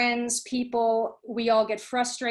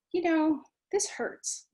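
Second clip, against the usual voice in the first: tender self-comfort; 6 semitones higher, slower than usual. Shown as a demonstration. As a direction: breathe this hurts out gently, almost unvoiced. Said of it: "put a hand on the heart and say".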